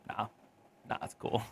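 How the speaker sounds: hushed nah, it's cool; breathy whisper of retreat; whispered brush-off. Retold or imitated. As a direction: whisper it, backing away. Dodging the occupied bathroom.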